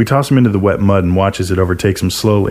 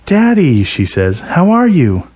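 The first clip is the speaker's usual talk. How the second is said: higher, sugary greeting to daddy; sweet daughter; 9 semitones higher, slower than usual. A narrator's reading. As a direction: coo it up for her father. Lisa greets her father sweetly.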